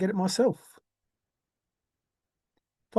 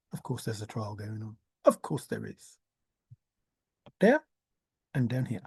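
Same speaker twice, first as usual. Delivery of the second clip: ordinary talk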